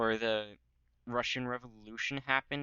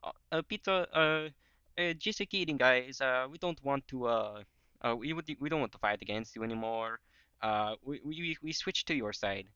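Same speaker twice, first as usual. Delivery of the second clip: higher-pitched, stammering put-on voice; mock Italy turncoat; 4 semitones higher than usual. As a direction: backpedal in a hammed-up character voice. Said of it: "do the classic Italy move and be like".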